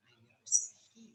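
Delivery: whispered, confiding aside; hushed denial. Shown as a demonstration. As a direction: drop to a quick whisper. Replays the accusation hushed.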